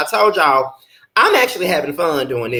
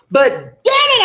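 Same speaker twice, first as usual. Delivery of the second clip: sudden outburst; 5 dB louder than usual. Real, not acted. Sudden outburst about the slap.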